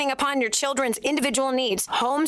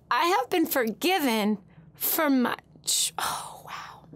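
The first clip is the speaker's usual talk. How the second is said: awed whisper; slower than usual. Real, not acted. To her listeners, remembering being forgiven: a hushed, awed whisper. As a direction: breathe it out slowly, unvoiced and hushed.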